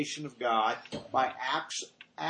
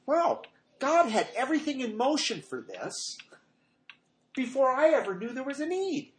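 amazed wow; 11 semitones higher, 4 dB louder than usual. Retold or imitated. Amazed realization quoted.

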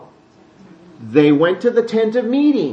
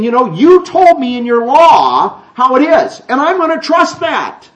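earnest believer; 7 semitones higher, 6 dB louder than usual. Retold or imitated. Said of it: "you trust in him by saying".

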